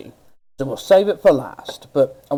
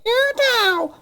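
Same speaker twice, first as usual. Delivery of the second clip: very high cartoon towel voice; Towelie impression. Quoting Towelie while mocking snowflakes. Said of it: "pull a Towelie".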